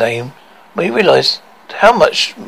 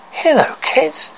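put-on high, sing-song kids' host; reading to children; 7 semitones higher than usual. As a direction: talk to little kids gently. Host addresses kids at story time.

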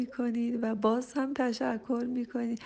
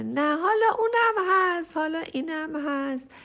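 high, loud imitation of an excuse-making parent; a light mock of a parent's excuse; mimicked excuse; 6 semitones higher, 7 dB louder than usual. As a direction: parrot the lame excuse, lightly mocking.

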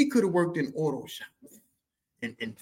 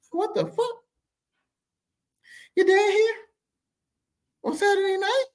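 put-on very high, louder shocked kid; shocked squeal; 5 dB louder than usual. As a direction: squeal in utter disbelief. Dad home on Saturday.

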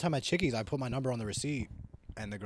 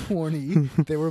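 loud, high, laughing echo; amused outburst; 8 semitones higher, 13 dB louder, slower than usual. Real, not acted. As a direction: blurt it back through a laugh. Laughs at friend's joke confession.

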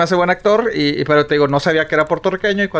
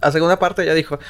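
ordinary delivery

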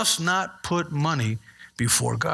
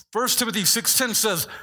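normal talking voice

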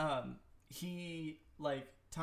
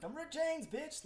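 imitated heckler's scream; 13 semitones higher, much slower than usual. Imitating someone screaming out of their car at Chappelle, mock yell. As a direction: imitate a passerby hollering a catchphrase. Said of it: "somebody screaming out of their car".

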